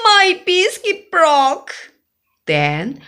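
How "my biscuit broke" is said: high, loud dismayed wail. Acted. Bella wailing at her playdate over a biscuit. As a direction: cry out at the broken biscuit.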